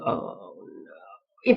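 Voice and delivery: soft, sinking oh no; dread. Retold or imitated. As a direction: let it drop low and soft, worried. Parent reacting to glum hi.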